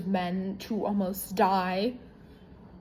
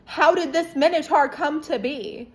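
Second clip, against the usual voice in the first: higher, much louder panicked outburst; mock panic, higher and louder; panicking; 5 semitones higher, 8 dB louder than usual. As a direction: blurt it out in a panic. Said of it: "So Circe is panicking".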